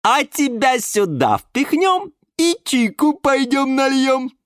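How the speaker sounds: high, loud, slow lilting auntie; singsong. A narrator's reading. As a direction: sing it out as you bustle about. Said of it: "пропела тётя Глаша". (напевная тётушка)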